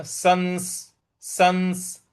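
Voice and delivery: ordinary talk, nothing marked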